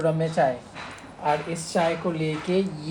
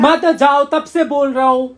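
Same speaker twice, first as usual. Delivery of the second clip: loud, raised-pitch telling-off; exasperated scolding; 6 semitones higher, 8 dB louder than usual. Real, not acted. Partner forbidding sick man to go to office, exasperated scolding. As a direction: scold loudly, fed up with repeating it.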